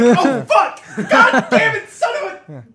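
high, loud comic panic cursing; panicked cursing. Retold or imitated. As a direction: blurt the curses in a squealing panic. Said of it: "And you're like".

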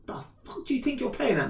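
high-pitched retold confrontation; re-enacted aggressive demand; aggressive challenge. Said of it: "walking aggressively towards him".

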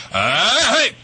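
sharp high "Hey!" to stop the barking; pimp shout. Pretend. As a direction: bark it out like a pimp. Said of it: "be a pimp, like".

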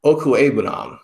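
"Okwuegbunam" carefully attempted after a pause; tentative pronunciation attempt. Shown as a demonstration. Co-host attempting a tricky player name, tentative care on "Okwuegbunam". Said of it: "I think it's something in the vicinity of".

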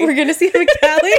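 fast, laughing echo. Real, not acted. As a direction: giggle through the words. Echoing while laughing.

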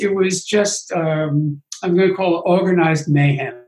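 normal talking voice

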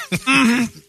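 high-pitched knowing mm-hmm; mugshot mm-hmm. Acted. Host voices a woman's mugshot, high sassy mm-hmm. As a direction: hum it as the voice of a mugshot. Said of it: "mugshot is literally the picture of the sound".

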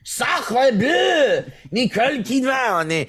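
high, exclaimed cartoon French accent; mock French accent. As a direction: cry out like a caricature Frenchman. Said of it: "Can you do it in a French accent?".

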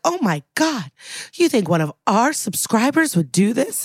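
mock gasp of shock, high at the start; pearl-clutching shock. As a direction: clutch your pearls, hammed-up horror. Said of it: "John Waters clutches his pearls like".

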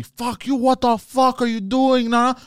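high, slow mock yell at the driver; retold yelling. Skater yells at the driver who honked, mock angry yell. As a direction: ham up the skater's outburst. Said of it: "starts yelling at me".

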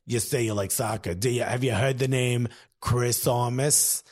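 low, deliberate New York mother impression; New York accent. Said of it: "and she's like".

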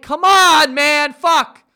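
a hammed-up, exasperated yell; frustrated outburst. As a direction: blurt it out loud in frustration.

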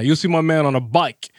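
ordinary delivery

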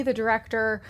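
ordinary delivery

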